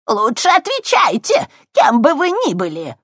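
put-on loud, high threatening old crone; threatening old woman. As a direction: shout a warning into the dark room. Old woman threatens hidden intruders. (угрожающий крик старухи)

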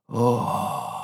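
a strange, otherworldly "О!"; a hint of a monster's moan; eerie creature cry. A narrator's reading. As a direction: answer like a lurking dark thing. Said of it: "ответила чёрная тварь".

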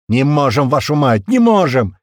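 loud, high, desperate cry; near-tears shout. A narrator's reading. Technician at his laptop failing, shouting near tears. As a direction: shout helplessly, close to crying. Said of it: "чуть не плача прокричал тот".

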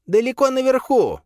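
very high squeaky creature. A narrator's reading. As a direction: squeak it like a small beast. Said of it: "пропищал он".

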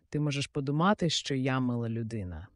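ordinary delivery, nothing marked

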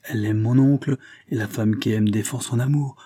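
ordinary delivery, nothing marked

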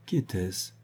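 soft, understated question; simply asked. A narrator's reading. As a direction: ask it simply and quietly. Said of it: "elle dit simplement".

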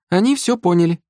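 high-pitched, pleased voice; self-satisfied. A narrator's reading. Sister reassuring her brother about the captives, self-satisfied. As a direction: point it out contentedly, high. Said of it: "довольно цокнула языком Галка".

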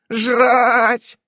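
high, loud grumbled "Жрать!"; grumbling footnote. A narrator's reading. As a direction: grumble the crude word disapprovingly. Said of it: "ворчит сноска".

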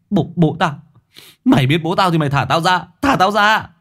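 high, loud, frantic yell; shouting. A narrator's reading. Captive shouting at the kidnapper, frantic. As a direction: scream the demand. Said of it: "vọng lên tiếng hét".

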